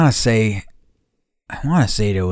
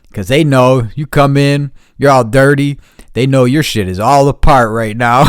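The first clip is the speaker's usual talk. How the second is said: louder and higher, worked up; heated rant; 5 semitones higher, 8 dB louder than usual. Retold or imitated. Stevie complaining that the shop knows you're stuck, loud and heated. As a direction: rant like you've been ripped off before.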